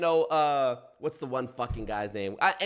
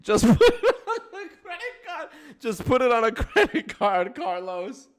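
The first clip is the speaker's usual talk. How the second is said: high and louder, words squeezed out between laughs; laughing through words; 10 semitones higher, 5 dB louder than usual. Real, not acted. Streamer joking about the credit card scam, laughing through it. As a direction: try to get the line out while cracking up.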